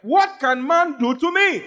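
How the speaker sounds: high, slow defiant shout; triumphant shouted question; preaching shout. Acted. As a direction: hurl the challenge out.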